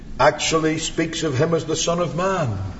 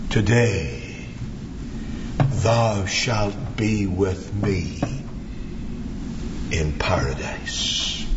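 low, very slow, hushed promise; tender; 4 semitones lower, much slower than usual. Retold or imitated. Preacher voices the Saviour answering the dying thief, tender. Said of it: "with the greatest tenderness".

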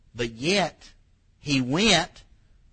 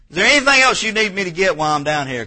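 loud, high call asking what else is needed; 7 semitones higher, 9 dB louder, faster than usual. Acted. Wife asking husband from downstairs, loud and high. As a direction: call it out across the house.

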